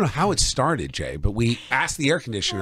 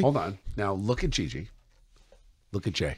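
hushed; 4 semitones lower, 9 dB quieter than usual. Real, not acted. Hushed pointing at dogs.

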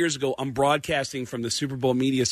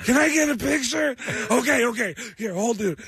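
high-pitched weepy pleading; mock crying; 8 semitones higher than usual. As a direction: beg through exaggerated sobs. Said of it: "every impression I did was of somebody crying".

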